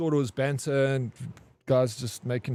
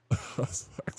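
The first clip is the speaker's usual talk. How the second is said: laughing; 8 dB quieter than usual. Real, not acted. Laughing after the shower scene.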